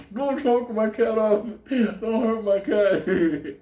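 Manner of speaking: mock plea. Boss begging them not to hurt his cat, laughing mock plea. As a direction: whine for the cat while cracking up.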